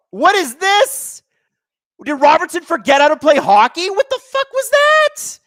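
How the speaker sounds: incredulous outrage. Real, not acted. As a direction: rant at the dive in disbelief. Outraged at a dive call.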